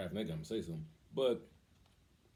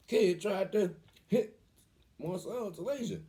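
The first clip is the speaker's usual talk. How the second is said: higher, slow voice of a daughter telling dad; child reporting; 9 semitones higher, 3 dB louder, slower than usual. Retold or imitated. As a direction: lightly play the daughter tattling. Voicing his daughter reporting to him.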